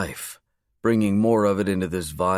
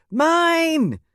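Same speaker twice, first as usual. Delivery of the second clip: put-on high, loud child's shriek of delight; excited screech; 5 dB louder than usual. Bailey claiming the upcoming birthday, in an excited high screech. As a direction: shriek it out, thrilled. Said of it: "she screeched".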